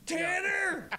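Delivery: high, gleeful shout of the cop's name; mocking cry. Real, not acted. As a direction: fling the name out in delighted scorn.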